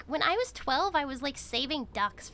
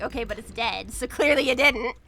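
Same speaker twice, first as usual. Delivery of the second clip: retold sharp comeback; 10 dB louder than usual. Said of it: "I was like".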